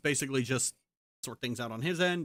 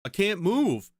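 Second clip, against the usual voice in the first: mocking whiny father; 4 semitones higher, 3 dB louder, slower than usual.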